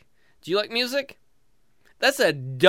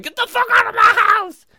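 high, loud mock shriek; angry woman shouting; 8 dB louder than usual. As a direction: scream as a furious stranger throwing him out. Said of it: "she's like".

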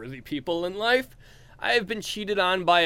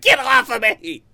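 shrieked protest; 7 semitones higher, 7 dB louder than usual. Retold or imitated. Woman fighting off cuddler.

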